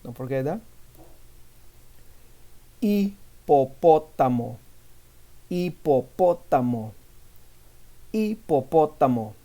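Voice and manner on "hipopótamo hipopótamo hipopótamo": Hipopótamo said with no h sound, starting straight on the i; silent h. Shown as a demonstration. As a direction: drop the H entirely in Hipopótamo. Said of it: "the H in Spanish doesn't have a sound".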